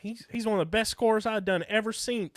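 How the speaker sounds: put-on old-timer voice. Old-timer bit, high voice.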